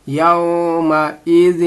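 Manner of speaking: "يَوْمَئِذٍ" slow, the final n hidden in a held hum; ikhfa recitation. Shown as a demonstration. Teacher showing ikhfa, slowly chanted "يَوْمَئِذٍ" with held nasal ending. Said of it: "یہاں پہ اخفا ہوگا".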